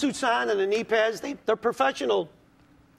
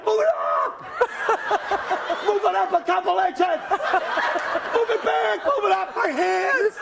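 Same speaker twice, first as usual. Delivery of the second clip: retold yelling; 8 semitones higher, slower than usual. Comic yelling at his wife to move the car. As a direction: yell directions at the wife from under the car. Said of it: "I'm yelling to my wife".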